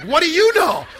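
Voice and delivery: high, loud burst of amazement; amazed outburst. Retold or imitated. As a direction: blurt it out in amused wonder. Amazed at his own words.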